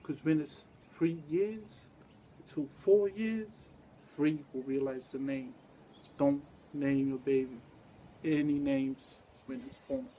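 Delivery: loud, forceful preaching build. Acted. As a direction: hammer the warning home to the crowd. Preaching about naming babies.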